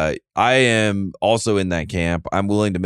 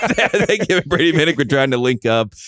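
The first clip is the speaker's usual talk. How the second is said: laughing; 8 semitones higher than usual. Real, not acted. Laughing at co-host's joke.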